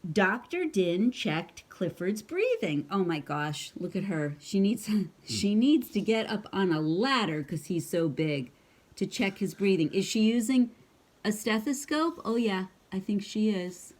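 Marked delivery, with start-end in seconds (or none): none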